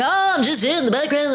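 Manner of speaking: hint of a goofy put-on voice; goofy impression. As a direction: lightly put on a silly modest athlete. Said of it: "I don't know why he talks like that".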